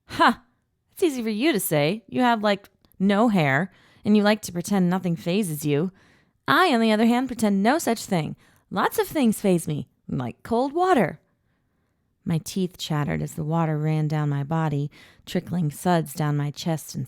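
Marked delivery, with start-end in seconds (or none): 0.1-11.2 high, loud, fast teasing; animated retort. A narrator's reading. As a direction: fire back playfully.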